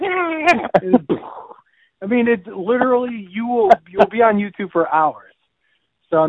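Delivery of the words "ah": high startled goat cry; fainting goat scream. Retold or imitated. Describing fainting goats to cohost, high scared goat yelp. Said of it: "They're like".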